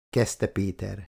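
plain reading voice

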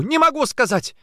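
very high, loud cry; desperate refusal. A narrator's reading. Woman under interrogation refuses name, desperate cry. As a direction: cry out, cornered.

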